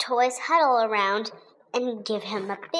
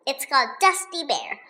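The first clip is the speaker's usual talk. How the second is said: usual speaking voice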